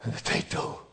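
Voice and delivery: hushed aside to nobody in particular; whisper. Real, not acted. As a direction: murmur it under your breath, almost unvoiced. Quiet aside before the sermon.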